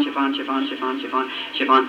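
soft, rote repetition under his voice; repeating to himself. Retold or imitated. Husband returning to his errand word, quietly repeating to himself. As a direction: drop back into memorizing, softly. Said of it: "I was going down the street saying".

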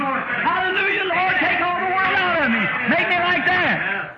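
a convert's overjoyed, slow high cry; ecstatic scream. Retold or imitated. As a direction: shout it up to heaven in rapture. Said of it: "he just screamed and jumped up and down".

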